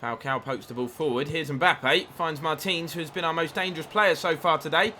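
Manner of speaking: ordinary talk, nothing marked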